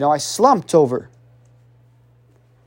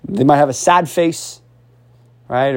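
ordinary delivery

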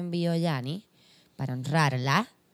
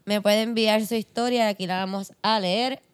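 ordinary talk, nothing marked

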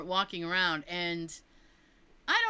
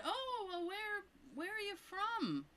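imitating a woman's question, pitched much higher and slow; curious local woman; 13 semitones higher, 10 dB quieter than usual. Upstairs woman asking the visitor where she's from, high and slow. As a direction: ask it high and drawn out like the nosy lady upstairs.

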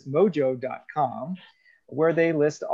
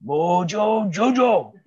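Mojo Jojo impression; 4 semitones higher, 5 dB louder, slower than usual. Everyone voicing Mojo Jojo.

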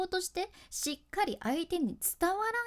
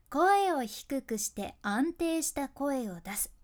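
slow, measured delivery of the tip; slow steady voice; slower than usual. Shown as a demonstration. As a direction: lay each word down calmly and slowly. (「声を低くして」をゆっくり安定して)

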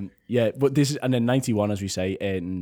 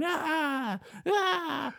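high strained mock screams; imitated screaming. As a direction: squeal it out like a driver losing it. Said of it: "he's going".

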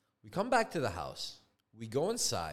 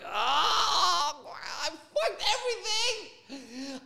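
high, drawn-out hammed-up wail; mock despairing wail; 13 semitones higher, 4 dB louder, much slower than usual. Homeowner realizing he hired badly, mock despairing wail. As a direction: wail it out, overdramatic. Acts out realizing his mistake.

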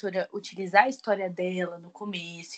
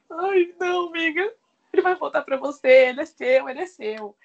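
high, gushing comfort to a friend; mock consoling friend; 6 semitones higher, 6 dB louder than usual. Reader consoling the heroine whose husband left, gushing. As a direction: coo reassurance at the heroine. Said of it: "Eu fiquei".

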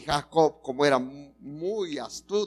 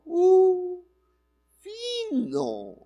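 playful drawn-out fino; much slower than usual. Real, not acted. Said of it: "playful exclamation about mothers' hearing".

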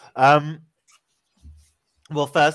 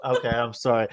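ordinary talk, nothing marked

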